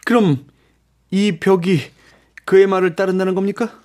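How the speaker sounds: high, halting astonished question; incredulous. A narrator's reading. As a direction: ask in disbelief with pauses. Raoul incredulous that wall obeys Erik.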